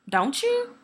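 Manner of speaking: small, high, hopeful plea; pleading question. Acted. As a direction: ask softly, pitch lifting right up, needing a yes. Pleading for love to be returned.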